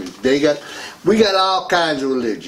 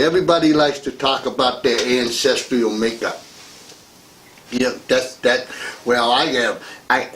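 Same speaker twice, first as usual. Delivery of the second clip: ordinary talk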